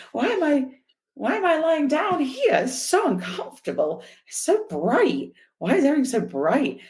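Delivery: high, bewildered wondering; dazed confusion. Retold or imitated. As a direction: play the woozy just-woke-up rider. Dazed after crash, retold.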